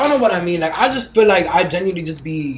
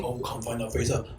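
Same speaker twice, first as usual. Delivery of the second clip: imitating him, lower and soft, teasing; mock sultry reply; 7 semitones lower, 12 dB quieter than usual. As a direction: drop the voice low and coy. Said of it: "that sound like on some".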